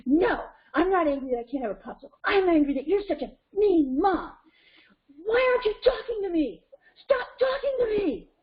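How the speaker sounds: mock tantrum climbing to a high screech; angry child. Child denied a popsicle rejects mom's empathy, escalating mock tantrum. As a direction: rage at mom, building to a high wail. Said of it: "here's what Cheetah, here's what Cheetah's gonna do".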